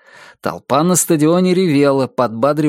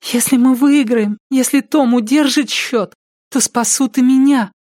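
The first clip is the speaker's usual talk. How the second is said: put-on high girlish voice, earnest; hopeful little girl; 12 semitones higher than usual. A narrator's reading. Lost girl tying her rescue to the game, high hopeful voice. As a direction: voice a child making a desperate bargain.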